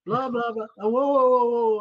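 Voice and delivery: loud, high string of whoas; retold protest. New coach halts the director mid-assignment, retold alarmed objection. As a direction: cut in to halt someone, acting it out.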